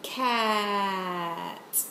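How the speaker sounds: "Cat" with a very long vowel; said slowly. Shown as a demonstration. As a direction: draw out "Cat" for the pupil. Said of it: "say the word slowly".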